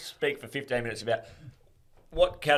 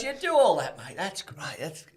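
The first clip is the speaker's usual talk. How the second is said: mock praise; 6 semitones higher than usual. [name] sarcastic praise.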